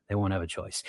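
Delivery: quiet repeat for weight; soft echo. Real, not acted. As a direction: let it fall away to a murmur. Echoes own line, dropping away.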